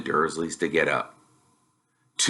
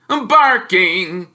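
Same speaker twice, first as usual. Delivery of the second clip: loud, higher mocking bluster; mock the boy's wizard school; scornful Uncle Vernon jeering; sneering uncle; 10 semitones higher, 6 dB louder than usual. Uncle scoffs.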